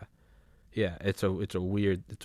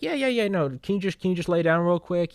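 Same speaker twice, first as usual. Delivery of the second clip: lightly voiced doctor, higher pitched; casual higher-pitched doctor talk, playing it down; calm cardiologist; 11 semitones higher, 5 dB louder than usual. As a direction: breezily imitate a reassuring doctor. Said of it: "that's what the cardiologists, like".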